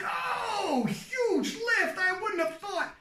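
high, excited commentator call; amped-up sportscaster reaction to a big lift; excited, inflected. Shown as a demonstration. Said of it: "getting a little excited. Show it".

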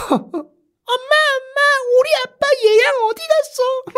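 put-on very high, childlike little girl; tiny child asking for her dad; little daughter voice. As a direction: squeak it out like a small child. Child voiced in retold story.

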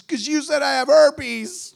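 high, loud, drawn-out wail; mock crying. Crying girl blaming pastor, hammed-up wail. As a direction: wail the accusation like a tearful girl. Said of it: "Why are you crying?".

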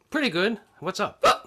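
hiccuping mid-word; hiccups. Real, not acted. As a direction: talk through the hiccups. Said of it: "I got the hiccups".

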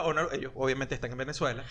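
usual speaking voice

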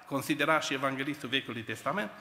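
normal talking voice

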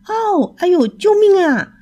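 high yelping cry for help while tumbling. A narrator's reading. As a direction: yell out in panic as you fall. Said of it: "他大喊大叫着滚到了楼下". (摔下楼梯时惊慌地高声呼救)

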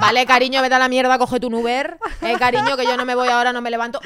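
loud, laughing fake-sweet dismissal; sugar-coat the brush-off while cracking up; mock-sweet brush-off. Girlfriend laughing off her boyfriend, loud mock-sweet dismissal. Couple years later, mock replies.

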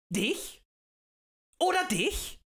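high, rising pointed questions; single out listeners one by one; agitator's provoking question; menacing challenge. A narrator's reading.

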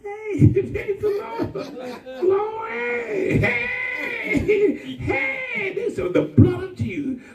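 hammed-up church praise with laughs; mock church shouting. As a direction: ham up a Holy Ghost shout. Mimicking praising church sisters.

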